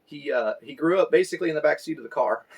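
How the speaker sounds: usual speaking voice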